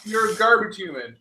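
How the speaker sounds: mock scolding. Scolding the careless dog owner for the dog, mock outrage. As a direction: scold her as if on the dog's behalf. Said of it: "Yeah, like".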